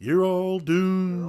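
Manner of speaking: slow, ominous, raspy drawl; doomsayer voice. Retold or imitated. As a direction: croak out the doom like a crazy old prophet. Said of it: "typical doomsayer".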